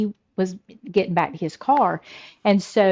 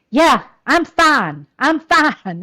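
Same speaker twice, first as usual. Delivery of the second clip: louder, higher mock-winded husband; imitated breathlessness; 6 semitones higher, 7 dB louder, slower than usual. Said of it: "you sound like you're so out of breath".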